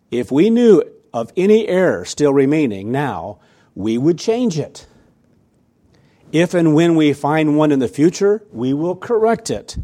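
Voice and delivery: loud, deliberate sermon reading; emphatic reading aloud. Acted. Preacher reads a church leader's letter to congregation, emphatic. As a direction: read it out forcefully, weighing each phrase.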